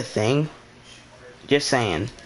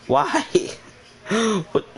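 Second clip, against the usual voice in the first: incredulous; 8 semitones higher than usual. Real, not acted. Incredulous outburst.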